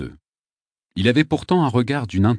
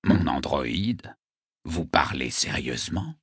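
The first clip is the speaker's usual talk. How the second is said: slow, low, hushed disbelief; surprised; 5 semitones lower, 6 dB quieter than usual. A narrator's reading. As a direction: ask it softly, taken aback. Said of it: "Le poète parut surpris".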